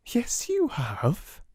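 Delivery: high, slow cheerful reassurance. A narrator's reading. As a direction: brighten the child up. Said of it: "said Sarah cheerfully".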